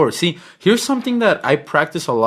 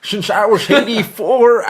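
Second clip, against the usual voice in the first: put-on old man voice; 7 semitones higher, 6 dB louder, slower than usual. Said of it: "old man voice".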